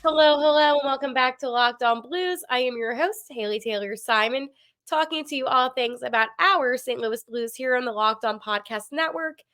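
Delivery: normal talking voice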